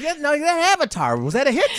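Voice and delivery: high mock voice of a clueless guy; high, airy impression of a guy behind the times; imitating him. Mocking the cohost with a high silly voice. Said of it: "He's like".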